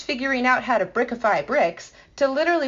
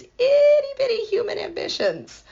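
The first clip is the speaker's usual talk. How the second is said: mock tiny; 4 dB louder than usual. Real, not acted. Mocking small human ambitions.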